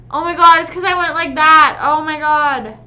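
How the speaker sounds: loud, high grossed-out cry; loud disgusted exclaim. Real, not acted. Grossed out by the blood.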